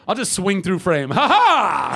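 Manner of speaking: booming warrior shout; loud hearty viking hero; heroic viking. Pretend. Cameo bit as his character.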